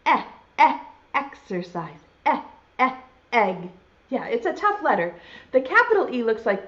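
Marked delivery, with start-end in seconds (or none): 0.1-2.0 two short, separate "Eh" sounds, then "exercise" dropping lower; letter E sound. Shown as a demonstration. Teacher likening the E sound to exercising, sounding out "Eh, eh, exercise". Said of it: "Kind of like you're exercising". 2.2-3.7 isolated short "Eh", repeated, leading into "egg"; letter E sound. Shown as a demonstration. Teacher repeating the E sound for children, sounding out "Eh, eh, egg". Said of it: "makes sort of a funny sound".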